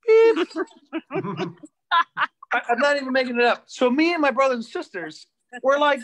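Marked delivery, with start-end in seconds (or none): 0.1-0.5 sharp, high, loud mock beep. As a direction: beep like an answering machine. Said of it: "outgoing message".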